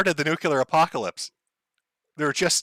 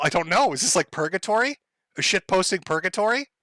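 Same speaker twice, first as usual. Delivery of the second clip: ordinary talk, nothing marked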